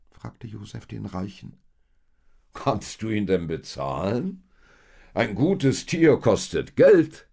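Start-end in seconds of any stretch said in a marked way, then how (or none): 2.5-7.3 loud, emphatic rich man driving a bargain; haughty rich seller. A narrator's reading. Rich man to Josef who wants a donkey, haughty.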